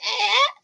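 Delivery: very high little squeal; baby creature cry. Acted. Game master voices the kappa baby, high squeal. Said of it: "So it's just like".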